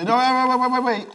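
high, loud rapid waits; urgent protest. Real, not acted. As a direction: stop them urgently. Protesting mid-challenge.

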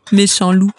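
plain reading voice

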